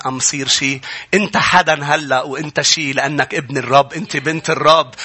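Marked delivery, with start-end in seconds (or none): none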